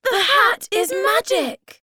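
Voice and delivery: high, loud joyful cry of discovery; excited shout. Acted. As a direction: shout it out, thrilled by the magic. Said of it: "Suddenly they shouted".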